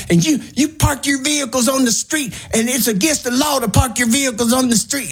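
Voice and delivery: high, whiny mock scold; mocking angry twin. Retelling the neighbor's scolding to the co-host, high mocking whine. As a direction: parody the little man scolding in a high voice. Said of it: "he's like".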